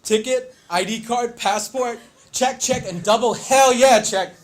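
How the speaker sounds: punchy checklist building to a triumphant yell; dad's checklist voice. Retold or imitated. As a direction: imitate a dad barking a travel checklist. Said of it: "I hear my dad's voice".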